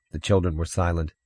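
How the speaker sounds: usual speaking voice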